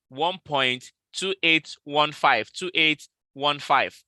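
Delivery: very slow, separated digits; dictating figures. Real, not acted. Trader giving followers the take-profit digits, dictated very slowly. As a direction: spell out each number slowly for note-takers.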